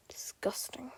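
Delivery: whispered, disgusted mutter. Acted. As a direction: hiss it under your breath. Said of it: "Fyodor's father mutters".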